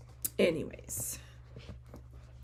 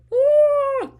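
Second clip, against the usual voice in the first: much higher, loud whoop of joy; excited woo; 15 dB louder than usual. Retold or imitated. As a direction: cheer like a thrilled fan. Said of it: "And we were both like".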